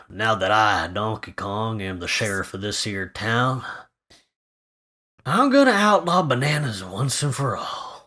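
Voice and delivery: gruff sheriff read. Pretend. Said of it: "just have a go".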